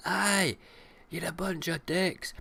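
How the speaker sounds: high, loud jeering shout; mock Scottish jeer. Scots heckler jeering at celebrities, high loud shout. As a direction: yell it at the celebrities like a heckling local. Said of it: "To be like".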